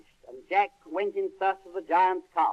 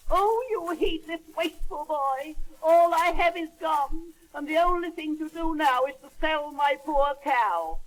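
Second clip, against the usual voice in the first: high, shrill-free scolding mother, much higher; 9 semitones higher than usual. Acted. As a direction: scold the boy in a high exasperated voice. Mother scolds wasteful son.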